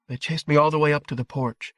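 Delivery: a hint of a boy trying to stay composed; boy holding back upset. A narrator's reading. Boy insisting to his parents they chased him, struggling for calm. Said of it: "He was struggling to keep his voice calm".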